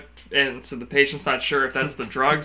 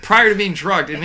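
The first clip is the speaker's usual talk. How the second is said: normal talking voice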